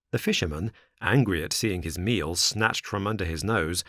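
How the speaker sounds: plain reading voice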